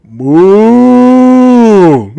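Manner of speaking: loud, high, long-held moo; cow moo. Acted. Fugitive foolishly hiding in a tree, mooing like a cow. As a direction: bellow like a cow, swelling and falling. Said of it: "Pony guy goes".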